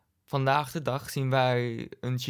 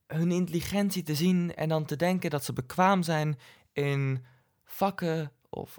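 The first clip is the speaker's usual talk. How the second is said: ordinary talk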